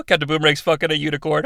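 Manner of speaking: usual speaking voice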